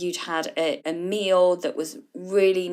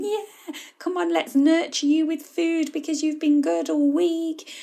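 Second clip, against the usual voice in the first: much higher, sing-song coaxing; indulgent parent voice; 8 semitones higher than usual. Acted. The nurturing parent rewarding her with food, much higher and coaxing. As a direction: coax with food like a doting parent. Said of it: "the parent voice going".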